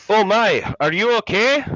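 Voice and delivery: high, loud Scottish cry of alarm; heavy Scottish accent. A narrator's reading. The intern rushes to the fallen actor, heavy Scottish accent. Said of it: "cried out in a heavy Scottish accent".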